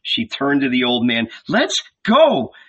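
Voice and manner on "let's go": high, firm order; brisk wifely command. A narrator's reading. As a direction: order him away firmly. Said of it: "she said in a commanding tone".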